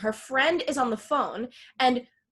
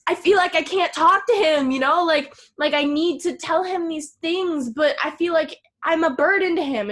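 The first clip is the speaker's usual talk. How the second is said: higher, louder acted-out plea; distressed venting; 5 semitones higher, 6 dB louder than usual. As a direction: imitate a girlfriend venting to her friend. Acts out Danny venting to friend.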